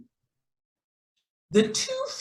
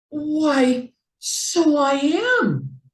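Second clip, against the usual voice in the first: higher, slow, amazed realisation; surprised; 9 semitones higher, 4 dB louder, much slower than usual. A narrator's reading. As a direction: discover he can swim after all. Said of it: "as surprised as he could be".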